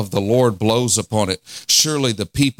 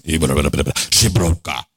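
lower, deep Trinidadian voice praying in tongues; deep Trinidadian accent; 7 semitones lower, much slower than usual. Retold or imitated. Preacher imitating his Trinidadian friend praying in tongues, deep Trinidadian accent. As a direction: rumble it out like the Uncola man in a Trinidadian accent. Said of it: "he sounds like this, the Uncola".

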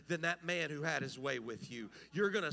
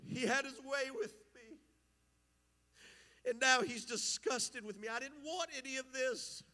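higher, pleading voice of a wronged sister; Tamar's lament; 5 semitones higher, 3 dB louder than usual. Acted. Tamar telling her brother what Amnon did, higher and pleading. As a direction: cry it out as the ravaged girl, pitched high.

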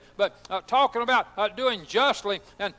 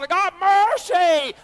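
higher, louder and slow, ringing out; exultant cry; 6 semitones higher, 5 dB louder, slower than usual. Real, not acted. Preacher crying out to the congregation, exultant and loud. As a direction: proclaim it like the best news ever.

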